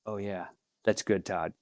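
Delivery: low reluctant that's good; a hint of grudging pastor. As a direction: grudgingly admit he did well. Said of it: "he goes".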